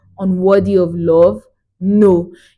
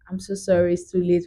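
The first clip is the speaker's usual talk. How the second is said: regretful refusal; 2 semitones lower, 8 dB quieter than usual. Retold or imitated. Voicing her quiet refusal.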